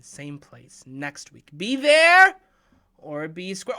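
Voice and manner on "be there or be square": loud, high, rising call; called-out sign-off. Real, not acted. Host signing off until next week, calling out. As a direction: belt out the catchphrase.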